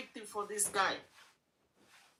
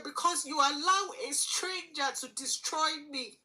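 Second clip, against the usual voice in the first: ordinary talk, nothing marked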